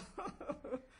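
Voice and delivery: high, spontaneous chuckling; amused laugh. Real, not acted. Teacher laughing at this very great wonder, amused. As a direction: chuckle at your own example.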